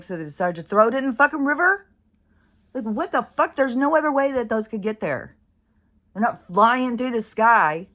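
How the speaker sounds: real exasperated outburst at people; indignant rant. Real, not acted. Ranting about dumped pet alligator.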